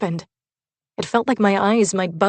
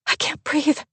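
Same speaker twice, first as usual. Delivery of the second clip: panicked gasp; 8 semitones higher than usual. A narrator's reading. Panicking after the shooting.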